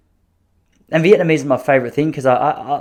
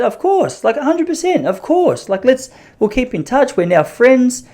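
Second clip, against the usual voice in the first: squeaky overeager agreement, much higher; eager high yes; 11 semitones higher, slower than usual. Retold or imitated. To the flirting owner, gushing eager high yes. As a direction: gush yes far higher than usual.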